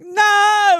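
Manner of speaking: loud, high mock wail; retold crying protest. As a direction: wail it out like a heartbroken kid. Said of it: "and I started crying".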